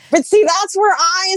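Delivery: ordinary talk, nothing marked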